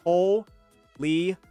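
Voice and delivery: ordinary talk, nothing marked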